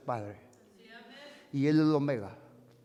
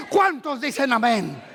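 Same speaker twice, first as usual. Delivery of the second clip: loud, raised call for an amen; rallying call; 7 semitones higher, 5 dB louder than usual. Acted. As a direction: call out to stir the crowd. Calling the congregation.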